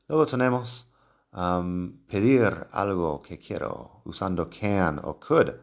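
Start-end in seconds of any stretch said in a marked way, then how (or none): none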